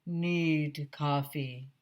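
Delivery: slow, low zombie groan; Mister Mackey groans for coffee like a zombie. Acted. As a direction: drag it out like the walking dead. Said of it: "groaned Mister Mackey".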